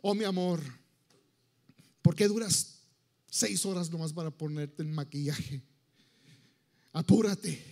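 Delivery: exasperated low nagging, punched loud; acted irritated complaint with loud bursts; impatient husband. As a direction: grumble at your wife to hurry. Acted grumpy husband hurrying wife.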